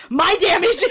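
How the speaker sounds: high, loud indignation with laughter; outraged retort. Retold or imitated. Sister turns round after the insult, loud outraged retort. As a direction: fling the question back, loud and offended.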